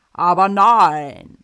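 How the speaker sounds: very loud, slow, hollow booming voice; loud hollow echo. Acted. Voice from the toilet answers, loud and echoing. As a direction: boom it out like an echo from a pipe. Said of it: "laut, hohl und hallend".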